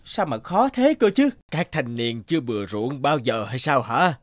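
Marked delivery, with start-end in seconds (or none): 0.0-1.3 straining effort. Acted. Straining to drive the plough.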